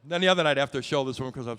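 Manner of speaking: ordinary talk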